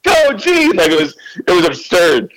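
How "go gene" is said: high cheering shout. Retold or imitated. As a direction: cheer him on wildly. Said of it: "cheering him on and going".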